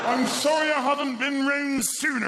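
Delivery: high, loud cheery neighbour apologising; cheery villager. Retold or imitated. A villager apologising on the doorstep, high and loud. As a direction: play the overly friendly villager at the door.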